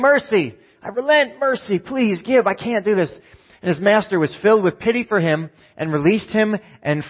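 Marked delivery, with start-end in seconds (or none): 0.0-3.2 high pleading cry for mercy, hammed up; mock begging. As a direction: beg the master like a desperate debtor, pitched up. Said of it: "He's begging for mercy".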